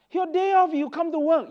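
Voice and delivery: disbelief. Retold or imitated. Staff walking dog on day off.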